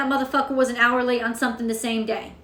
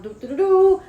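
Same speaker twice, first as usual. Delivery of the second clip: mock horn fanfare; 5 semitones higher, 4 dB louder than usual. Imitating a herald horn.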